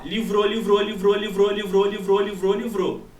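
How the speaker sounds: LIVROU chanted steadily on a level pitch; imitated echo. Shown as a demonstration. Teacher showing students the response time of sound, echo chant. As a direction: repeat LIVROU in a loud even loop. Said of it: "Vai ter a situação".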